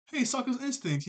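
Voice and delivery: high, teasing call; mock calling out. Acting out teasing call to instincts.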